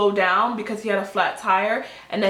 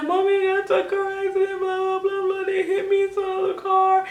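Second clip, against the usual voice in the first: high, fast babbling to mom; squealed rush of mock distress; retold panicked wail; 11 semitones higher than usual. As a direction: act out a hysterical child-like whine. Panicked call to mother, retold.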